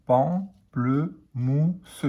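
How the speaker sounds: stretched syllable by syllable; slow teacher blending. Shown as a demonstration. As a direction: draw out "Pamplemousse" slowly, pam-ple-mousse. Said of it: "Un P en pan".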